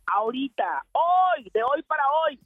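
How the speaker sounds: ordinary delivery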